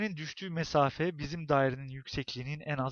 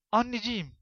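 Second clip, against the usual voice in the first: high, louder pleading cry; child's cry for help; 5 semitones higher, 6 dB louder than usual. A narrator's reading. Old woman voices a child calling mother, pleading cry. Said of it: "diye bağırdığını".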